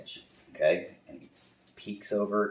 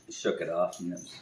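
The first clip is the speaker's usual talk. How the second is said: plain reading voice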